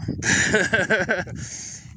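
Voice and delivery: high-pitched burst of laughter; real, rolling laugh at your own story. Real, not acted. Laughs at breaking own rule.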